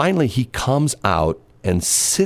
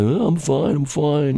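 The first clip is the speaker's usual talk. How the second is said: lightly imitated drunk man, pitched up; slightly drunk; 7 semitones higher, slower than usual. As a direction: wave off concern as a tipsy man, lightly. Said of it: "you can tell he's, like, sort of a little drunk".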